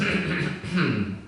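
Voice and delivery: demonstrated nasal throat clear; throat clearing through the nose. Shown as a demonstration. Yoga teacher showing students how, throat cleared through the nose. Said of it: "clear the throat through the nose".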